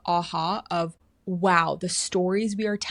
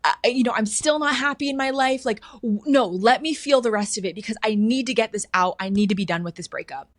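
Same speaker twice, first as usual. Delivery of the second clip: grieving protest; 5 semitones higher, 6 dB louder than usual. Retold or imitated. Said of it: "And I go".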